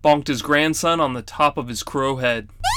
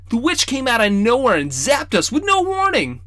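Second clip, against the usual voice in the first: put-on boy explaining; 10 semitones higher, 4 dB louder than usual. Boy excuses himself.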